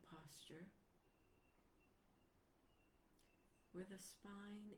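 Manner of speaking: unhurried meditation guide, long pauses between phrases; guided meditation. Real, not acted. Teacher guiding listeners into their posture, guided meditation voice. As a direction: ease listeners gently into stillness, leaving space after each phrase.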